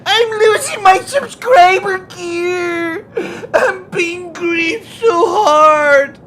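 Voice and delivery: high, loud, slow whining cry; mocking whiny player. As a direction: whimper it like a spoiled gamer.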